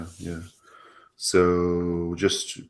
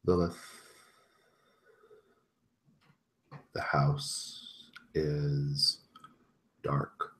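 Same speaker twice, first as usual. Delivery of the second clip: slow, hushed narration with long pauses; game master's scene setting; 6 dB quieter, slower than usual. Acted. Game master leading Lilith into a moonlit house, hushed. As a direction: paint the eerie house slowly, letting each image hang.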